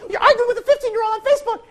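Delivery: high, fast, loud wife; wife scolding. Retold or imitated. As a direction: nag him like the wife. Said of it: "And then my".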